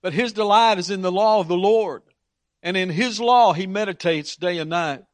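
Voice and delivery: psalm reading. Acted. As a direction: read the verse with reverent lift. Reading psalm aloud in sermon.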